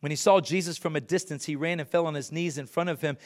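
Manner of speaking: fast, brisk scripture recap; hurried retelling; quick reading. Shown as a demonstration. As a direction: rattle through it quickly. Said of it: "reread faster".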